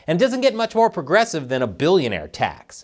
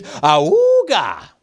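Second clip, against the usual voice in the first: loud, high cartoon "awooga" yell; mock catcall scream; 4 semitones higher, 5 dB louder than usual. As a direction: holler it like a leering cartoon horn. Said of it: "stares at a woman and screams".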